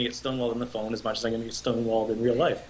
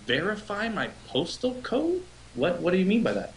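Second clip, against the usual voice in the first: mock incredulity; 9 semitones higher than usual. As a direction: act thrown by the request, pitched way up. Acted baffled reply to collection caller.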